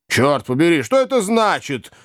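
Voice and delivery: high-pitched, indignant outburst from the host; startled exclamation. A narrator's reading. Host demanding to know what the commotion is, startled exclamation. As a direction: exclaim it sharply, pitch jumping up. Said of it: "воскликнул хозяин".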